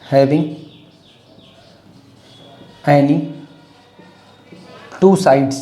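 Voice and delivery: each word spaced and separate; slow dictation. Shown as a demonstration. Teacher dictating the key wording, word by word. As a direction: dictate word by word with long gaps. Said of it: "ये wording important है".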